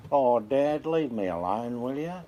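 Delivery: put-on bush boy voice; Dave from Dad and Dave. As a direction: whinge back at a nagging father. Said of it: "a show on my channel called Dad and Dave".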